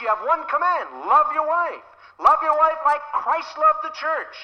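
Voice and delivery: high, loud pulpit preaching; full-force sermon delivery; fiery preaching. Acted. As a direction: preach it out to the congregation.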